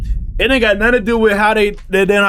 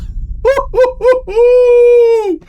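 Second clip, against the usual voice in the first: long high-pitched hoot of disbelief; high whooping howl; 4 dB louder than usual. Real, not acted. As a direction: whoop high and loud, holding the note. Howling at the news reveal.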